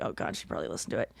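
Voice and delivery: low, very soft, hurried worry to oneself; hushed aside. Retold or imitated. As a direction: quickly murmur a private worry under your breath. Dreading mom heard the podcast.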